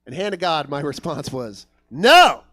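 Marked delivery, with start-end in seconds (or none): 1.9-2.4 loud, higher one-word refusal; blunt refusal. Retold or imitated. Comic answering a knife-holding robber, loud flat-out refusal. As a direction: blurt it out at full volume.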